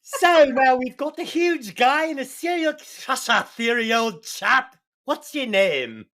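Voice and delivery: hammed-up British detective; British accent. Host playing Sherlock Holmes, bad British accent. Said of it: "worst British accent I've ever heard".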